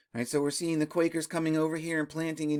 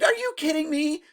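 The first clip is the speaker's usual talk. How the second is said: high-pitched, amused disbelief; incredulous reaction; 9 semitones higher, 4 dB louder than usual. Retold or imitated. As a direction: retell your own astonished reaction. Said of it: "I was like".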